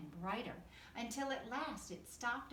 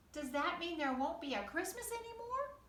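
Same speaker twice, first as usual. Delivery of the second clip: higher, anxious fox voice; worried little fox; 8 semitones higher than usual. Acted. Fox fearing no more Christmas, worried higher voice. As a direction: ask it like a small fox afraid Christmas is gone. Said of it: "asked the fox".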